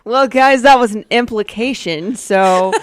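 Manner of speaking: loud burst, then laughing sign-off. Real, not acted. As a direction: announce it while cracking up. Laughing at accidental drug implication.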